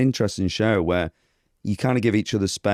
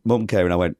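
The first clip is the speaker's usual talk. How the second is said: ordinary talk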